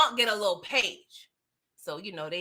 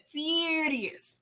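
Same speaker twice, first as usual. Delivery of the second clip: ordinary talk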